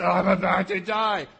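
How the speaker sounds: loud, exaggerated "I'm about to die"; mock-tragic overstatement; melodramatic dying. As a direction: ham up a dramatic wail of dying. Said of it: "And now you have Esau".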